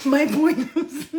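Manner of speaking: slow, high, through laughter; can't get it out for laughing; giggling at her own point. Real, not acted.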